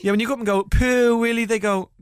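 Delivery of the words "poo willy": high, loud silly yell; loud, high playground shout; mock shout. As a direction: bellow the rude words to set kids off. Shouting rude words at kids.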